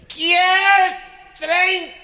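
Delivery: put-on very high, very loud drunken shout; screaming drunk order; drunken yell.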